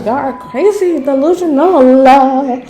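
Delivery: high, drawn-out disbelief at roommates; talking to her ex-roommates, high and drawn-out in disbelief; exasperated disbelief. Real, not acted. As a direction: stretch delusional out high in disbelief.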